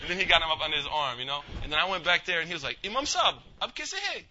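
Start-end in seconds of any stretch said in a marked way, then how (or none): 2.8-4.2 high-pitched eager hello; imitating a high-voiced Urdu speaker; shopkeeper's greeting. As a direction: greet the imam in a high put-on voice.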